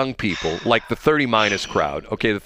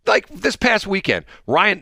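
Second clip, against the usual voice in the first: ordinary talk, nothing marked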